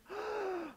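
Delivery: sharp high gasp; mock gasp. Disciples hear of betrayal, high mock gasp.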